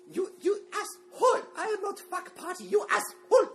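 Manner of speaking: put-on high, loud furious squeal in a cute Japanese accent; mock Japanese accent. Imitating his ex-girlfriend yelling in a high shriek. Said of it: "cute little Japanese accent".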